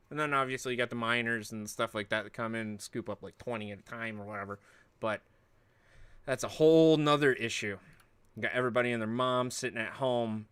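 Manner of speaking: ordinary talk, nothing marked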